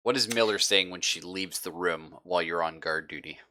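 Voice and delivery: ordinary talk, nothing marked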